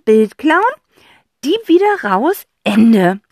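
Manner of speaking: put-on high, loud girl's voice piecing it together; a bright girl listing the steps of the theft; excited girl. Cassie sums up the break-in for her brother, eagerly.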